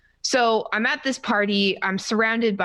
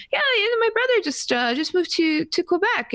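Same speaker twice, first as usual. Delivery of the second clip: high, quick, stumbling chatter; nervous babble; 11 semitones higher than usual. Retold or imitated. Shaking fan rambles about brother to streamer, nervous. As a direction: re-enact rambling at an idol through the jitters. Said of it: "shaking at this point".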